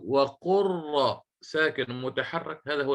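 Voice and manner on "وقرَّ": وقر said as waqurra, long R released into A; doubled open ra. Shown as a demonstration. Teacher builds the merged letter for students, doubled ra with fatha. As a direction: hold the R of وقر, then open it on a short A. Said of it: "أتيت بالراء التي بعدها المفتوحة فصارت".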